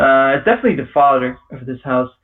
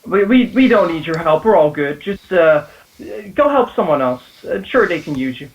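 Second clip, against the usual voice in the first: nervous father. Acted. GM voices nervous father behind door.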